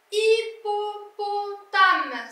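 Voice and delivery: hippopotame stretched very slowly, sung out; teacher slowly sounding out hippopotame; slow syllable-by-syllable reading. Shown as a demonstration. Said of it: "syllables drawn out for learner".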